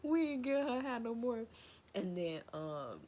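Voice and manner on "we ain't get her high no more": high, louder mock of the cousin saying "We ain't get her high no more". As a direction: parrot the cousin in a squeaky voice. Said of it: "He was like".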